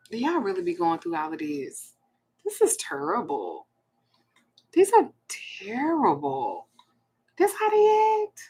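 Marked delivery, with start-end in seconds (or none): 2.4-8.4 appalled. Real, not acted. Appalled at men's messages, to viewers.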